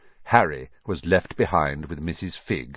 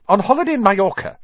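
fast, high, louder retort; snapped; 13 semitones higher, 6 dB louder, faster than usual. A narrator's reading. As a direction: snap it back irritably. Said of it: "snapped Aunt Petunia".

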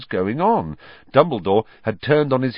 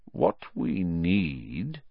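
very slow, drawn out, softer; slowly; 3 semitones lower, 5 dB quieter, much slower than usual. A narrator's reading. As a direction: weigh each word slowly. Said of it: "said Dumbledore slowly".

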